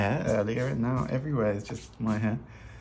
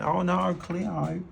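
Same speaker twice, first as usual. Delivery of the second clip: put-on Australian accent; 9 semitones higher, much slower than usual. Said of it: "where this Australian accent comes from".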